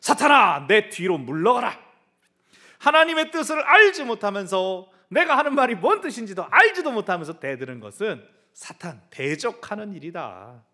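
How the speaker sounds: Jesus sternly rebuking Peter; stern rebuke. Retold or imitated. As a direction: drive Satan back with authority. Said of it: "예수님 뭐라고 하셨습니까".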